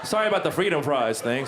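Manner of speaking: ordinary talk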